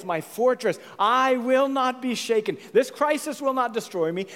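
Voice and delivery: building defiant proclamation. Acted. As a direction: build from firm recital to full-voiced defiance. Psalm builds to defiant declaration.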